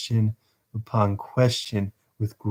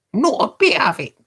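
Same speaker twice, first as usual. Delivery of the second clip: high Dodger voice; 11 semitones higher, 3 dB louder than usual. Acted. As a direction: drag it out, high and scornful.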